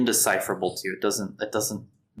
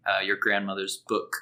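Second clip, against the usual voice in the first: usual speaking voice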